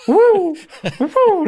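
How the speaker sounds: very high, loud whooping through laughter; laughing whoops. Retold or imitated. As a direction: squeal like officers sliding, laughing. Screws slipping on porridge.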